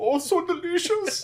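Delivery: very high, slow squeaky voice; high silly voice. Acted. Same silly character voice.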